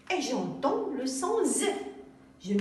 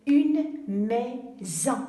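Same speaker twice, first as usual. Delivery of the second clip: teacher sounds out une maison slowly, a touch louder; drawn-out demo of maison with a voiced s; slow, stretched reading; 4 dB louder, much slower than usual. Shown as a demonstration. Teacher to pupils: slow sounding-out of the word. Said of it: "Regardons maintenant deuxième mot".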